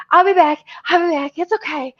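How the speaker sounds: high, hurried reassurance; retold breathlessness. Runner reassures housemates on the way out, breathless. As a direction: imitate someone out of breath from running. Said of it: "I'm out of breath".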